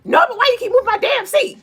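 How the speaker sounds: high, fast, sassy diva voice; Rasputia impression. Streamer quoting Rasputia scolding Norbit about the seat. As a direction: shriek it like a bossy wife. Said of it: "on the movie Norbit, when she was like".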